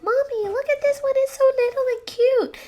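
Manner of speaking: put-on very high, loud little-kid voice; excited small child. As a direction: squeal it like a child begging Mommy. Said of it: "this kid finessed three".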